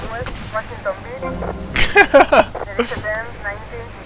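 high-pitched burst of laughter; amused cackle. Real, not acted. Player laughs at the nearby cop, high cackle. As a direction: crack up laughing.

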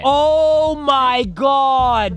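slow, loud drawn-out oh my God; shocked gasp. Real, not acted. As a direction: stretch it out in pure disbelief. Reacting in shock to video.

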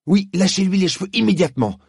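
high loud command, hitting immédiatement; exclaimed. Acted. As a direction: shout an order to free her. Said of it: "s'écria Diggory". (s'écrier, ordre pressant)

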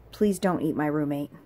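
usual speaking voice

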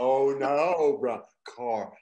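loud, overly open vowels on "Oh, no, brown car". Shown as a demonstration. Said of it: "using your overly open voice".

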